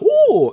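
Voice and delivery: high, loud mock "ooh"; mock tourists' oohing. Tourists taking pictures of him, loud mock oohing. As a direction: imitate an awed crowd's squealed ooh.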